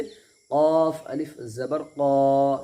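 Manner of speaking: slow spelling with a long held क़ा; stretched alif madd. Shown as a demonstration. Teacher demonstrating madd, sustained rounded vowel. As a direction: draw out the last क़ा for one alif. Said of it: "खींच करके अदा करेंगे".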